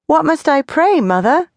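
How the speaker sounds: put-on high, innocent child's question; child asking. A narrator's reading. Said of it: "he asked".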